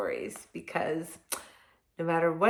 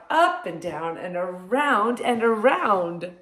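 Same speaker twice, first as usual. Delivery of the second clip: lively storybook read-aloud; reading to children; 4 dB louder than usual. Acted. Reading picture book to children.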